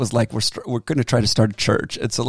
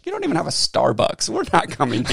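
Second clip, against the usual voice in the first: imitating wife; 6 semitones higher than usual. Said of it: "my wife was like".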